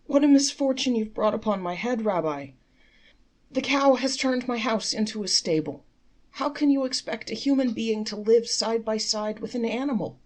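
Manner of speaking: high, loud lamenting complaint. Acted. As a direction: lament loudly to the rabbi. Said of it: "lamenting loudly".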